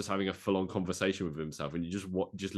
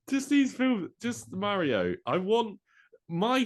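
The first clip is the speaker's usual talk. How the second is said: squealing with a laugh, much higher; giddy high squeal; excited; 7 dB louder, slower than usual. Real, not acted.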